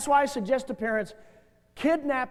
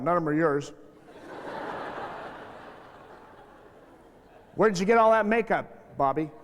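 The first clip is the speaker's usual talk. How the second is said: nagging parent; 7 semitones lower than usual. Retold or imitated. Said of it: "They walk in the door".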